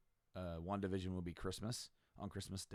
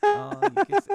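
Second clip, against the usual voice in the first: very high, loud teasing squeal; mock taunting; 17 dB louder than usual. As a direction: jeer like a prancing sister, way up high. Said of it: "she's, like, prancing around".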